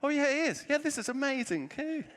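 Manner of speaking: forced enthusiasm. Retold or imitated. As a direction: fake cheer while terrified. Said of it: "And I was like".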